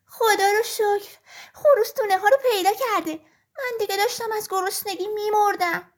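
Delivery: a hungry hen overjoyed; happy hen. A narrator's reading. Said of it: "با خوشحالی گفت".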